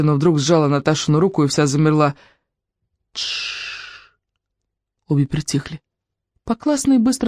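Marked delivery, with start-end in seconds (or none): 3.1-4.1 drawn-out unvoiced shush; long warning shush. A narrator's reading. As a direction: hush a friend softly with a long hiss. Girls hush as someone approaches.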